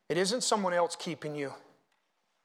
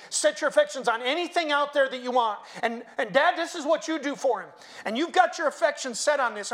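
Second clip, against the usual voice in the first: ordinary delivery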